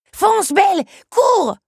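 cri aigu à pleins poumons; hurle-lui de fuir, très haut et fort; s'époumoner. A narrator's reading. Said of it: "s'époumonna Lucanos". (Lucanos s'époumone pour que Belle fuie, cri aigu)

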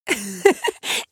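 acted burst of giggles; hearty laugh. A narrator's reading. Belle doubled over in front of the beast, laughing. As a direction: break into a helpless giggle. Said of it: "elle riait à gorge déployée".

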